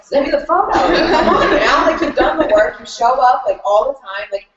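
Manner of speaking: high, fast-rising, half-laughing, near-tearful urging; excited pleading. Retold or imitated. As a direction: cheer her on, laughing through tears, pitch climbing. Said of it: "crying because this girl".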